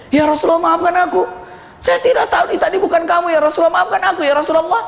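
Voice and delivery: high-pitched, flustered apology; urgent pleading. Acted. Woman begging the Prophet's forgiveness, urgent pleading.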